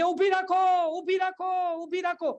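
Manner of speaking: high, urgent pleading to stop the bus; mock panicked plea. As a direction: pitch it up like a desperate passenger. Passenger yelling to stop bus.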